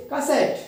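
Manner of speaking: Cassette with सेट high, then falling; stress on last syllable. Shown as a demonstration. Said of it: "सेट पर देंगे". (Cassette में सेट पर ज़ोर)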